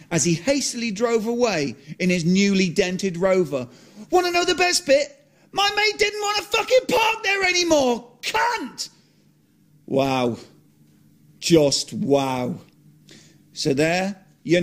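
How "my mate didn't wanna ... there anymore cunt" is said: high, spat-out curse; one angry word to cap the rant; mock furious curse. Bitter punchline after story.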